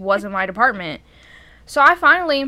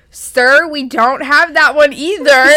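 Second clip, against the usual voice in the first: loud, exasperated, each word pushed out; fed-up loud reply; 2 semitones higher, 8 dB louder, slower than usual. Retold or imitated. Worker to pushy customer about the coffee pot, exasperated and loud. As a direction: lose patience and say it loudly.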